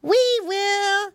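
put-on high drawn-out child voice; children chorusing in a high pitch; piglet children. A narrator's reading. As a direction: sing out like obedient little piglets. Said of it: "said Curly Tail and Flop Ear".

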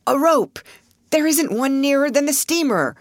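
high, dismayed exclamation; alarmed cry. A narrator's reading. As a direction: cry out at the setback. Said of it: "cried Mister Pertell".

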